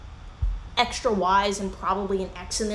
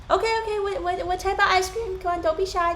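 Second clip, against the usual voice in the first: much higher, chirpy coaxing voice; coaxing server; 10 semitones higher than usual. Retold or imitated. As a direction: egg the shy kid on brightly. Worker coaxing shy kid.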